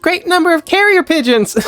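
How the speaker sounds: squeaky animated cartoon character; Thomas the Tank Engine voice. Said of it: "Thomas the Tank Engine".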